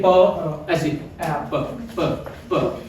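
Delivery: प released as a puff of air, no voice; voiceless प demo; breathy unvoiced p. Shown as a demonstration. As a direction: blow out प from the lips only. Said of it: "comes from the mouth only".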